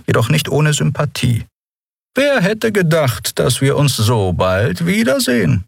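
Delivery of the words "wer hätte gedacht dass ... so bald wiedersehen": slow, dry officer's greeting, high. Acted. Captain Quudal greets Captain Nagun, dry and slow. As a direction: greet her dryly, taking time.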